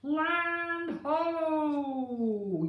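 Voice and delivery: loud, sung-out call of land ho; pirates' yell. Acted. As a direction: call it like a lookout spotting land. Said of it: "yelled the pirates".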